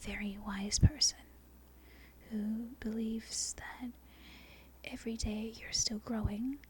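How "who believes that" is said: ordinary delivery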